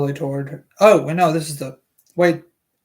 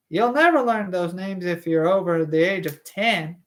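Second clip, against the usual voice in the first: higher female character voice; Alice Levin voice; 4 semitones higher than usual. Acted. Alice Levin to Doctor Stone, higher female voice. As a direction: play a chatty mother, pitched up. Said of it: "some sort of female voice".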